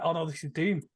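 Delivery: ordinary talk, nothing marked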